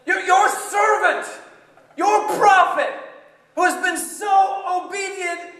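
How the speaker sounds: high, loud indignant shouting; angry complaint to God. Acted. Jonah shouting his complaint at God, indignant. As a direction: yell your grievance up at God. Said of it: "I was like".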